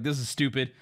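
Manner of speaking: low-voiced dealer, lightly imitated; retold dealer's low, casual aside; amused dealer. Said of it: "kinda laughing".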